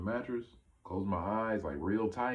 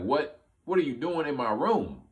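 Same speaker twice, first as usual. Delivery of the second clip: retold startled question, higher and louder; startled demand; 8 semitones higher, 7 dB louder than usual. Man to a strange kid in his room, startled. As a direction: act out baffled alarm, pitched up.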